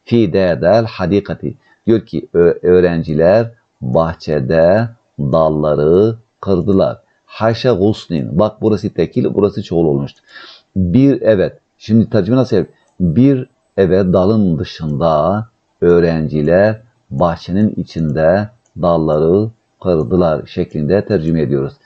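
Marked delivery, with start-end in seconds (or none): 2.6-7.0 very slow, stretched word endings; word by word, with pauses between; slow dictation. Shown as a demonstration. Teacher dictates the translation. 14.1-20.5 very slow, stretched word endings; word by word, with pauses between; slow dictation. Shown as a demonstration. Teacher dictates the translation.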